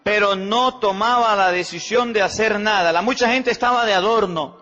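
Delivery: preaching rebuke. Acted. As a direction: drive the repeated phrase home to the congregation.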